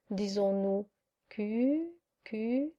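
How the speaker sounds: très bas, pour soi-même; marmonné en cherchant. Acted. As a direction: chercher le nom à voix basse, syllabe par syllabe. Saint Pierre cherche dans son livre. (en marmonnant, tout bas)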